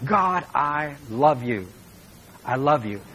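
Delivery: slow, tender and sincere; tender prayer. Shown as a demonstration. Preacher showing the congregation how to tell God they love him, slow and tender. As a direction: say it slowly, like a whispered vow to God. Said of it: "Let your ears hear your mouth say".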